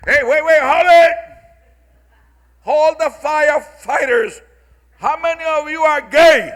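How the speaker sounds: high, loud, urgent yelling to stop them; frantic shout. Acted. Homeowner yelling at firefighters to stop, high frantic shout. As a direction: shout like your house is burning.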